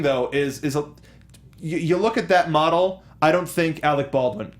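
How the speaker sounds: ordinary delivery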